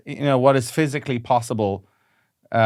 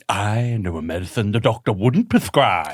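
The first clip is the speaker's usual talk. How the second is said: slow, theatrical wizard shopkeeper; slow hammed-up wizard welcoming you to his shop; annoying wizard voice; slower than usual. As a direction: roll it out like a fairground mystic. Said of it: "an incredibly annoying wizard voice".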